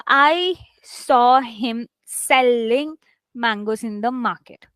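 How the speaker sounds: slow, with weight on "selling" and "mangoes"; stress on SELLING, MANGOES. Shown as a demonstration. Teacher showing stress on main verbs and nouns, "selling mangoes" stressed. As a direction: lean on SELLING and MANGOES, drawing the phrase out. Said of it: "I'll be stressing on mangoes also".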